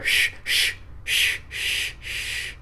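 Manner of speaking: long, soft hissed shushes; imitated shushing. Storyteller imitates an intruder hushing the barking dog, soft shushing. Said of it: "this is what I hear".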